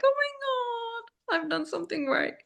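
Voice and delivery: high, soft, overcome retelling; soft squeal of a touched parent; tearfully moved. Retold or imitated. Mom realising her son wants affection, high soft tearful relief.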